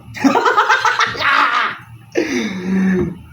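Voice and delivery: high, loud burst of laughter; shrieking laugh; loud laughing outburst. Real, not acted. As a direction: crack up mid-answer in a long loud laugh. Bursts out laughing mid-answer.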